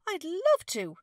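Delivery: high, loud delighted exclaim. A narrator's reading. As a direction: burst out with delight. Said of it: "Kaya exclaimed".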